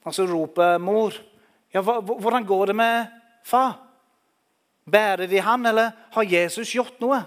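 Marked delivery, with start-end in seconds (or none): 1.7-7.2 higher, louder anxious call; mother asking what happened to father. Acted. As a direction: call out to the boys wanting news.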